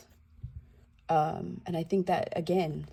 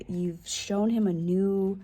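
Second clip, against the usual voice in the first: normal talking voice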